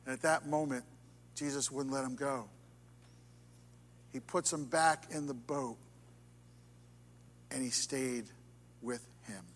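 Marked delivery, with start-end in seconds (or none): none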